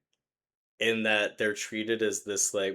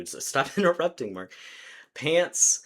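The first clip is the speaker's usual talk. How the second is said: ordinary delivery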